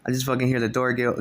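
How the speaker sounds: usual speaking voice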